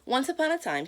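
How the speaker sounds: ordinary delivery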